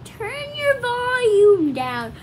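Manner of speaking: slow, high, loud call; loud drawn-out warning. Real, not acted. Kid warns viewers before screaming, slow and loud. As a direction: stretch the warning out loudly.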